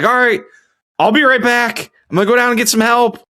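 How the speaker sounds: retold calling out. Man telling the trapped woman he'll return, high retold call. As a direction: call it through a closed door, pitched high. Calling back through the door.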